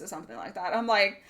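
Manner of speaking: ordinary talk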